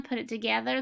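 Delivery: ordinary delivery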